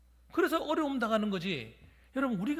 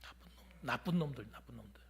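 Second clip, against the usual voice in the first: hushed, unvoiced whisper of an insult; under breath; 9 semitones lower, 8 dB quieter than usual. Real, not acted. Pastor mutters an insult under his breath to the congregation, whispered. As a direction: breathe the words out, almost voiceless.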